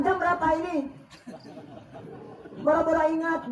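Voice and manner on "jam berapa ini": nagging wife. Retold or imitated. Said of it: "Nanya jam".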